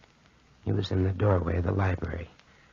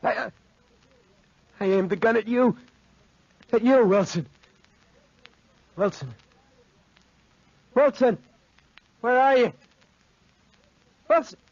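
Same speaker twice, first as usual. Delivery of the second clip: high, loud, panicked cries for Wilson; frantic wounded search; wounded desperate calling; 13 semitones higher, 5 dB louder than usual. Acted. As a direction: call out in pain and fear.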